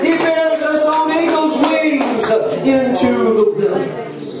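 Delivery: high, drawn-out revival scripture cry; full-force preaching. Real, not acted. Preacher rousing the congregation with Isaiah, soaring preaching. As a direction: build it into a soaring call to praise.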